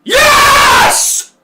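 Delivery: huge high-pitched celebratory scream; very loud scream. Real, not acted. Streamer announcing a scream to viewers, very loud celebratory scream. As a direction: scream a long, triumphant yes. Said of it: "scream very loudly".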